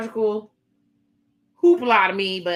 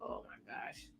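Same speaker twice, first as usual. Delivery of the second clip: breathless whispered oh my gosh; shocked whisper; 10 semitones lower, 22 dB quieter than usual. Real, not acted. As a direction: gasp it out under your breath. Shocked aside while fast-forwarding.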